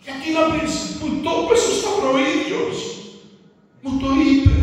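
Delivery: a preacher drives his point home to the church, forceful preaching. Real, not acted. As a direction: preach it to the congregation.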